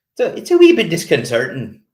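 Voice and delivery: high, loud mock Irish lilt; hammed-up Irish brogue; terrible Irish accent. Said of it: "That's a terrible Irish accent".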